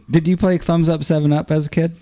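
usual speaking voice

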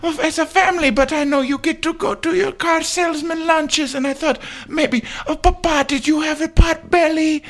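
high put-on kid voice; pleading child. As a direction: wheedle papa for a treat as a small child. Second child begs papa for food.

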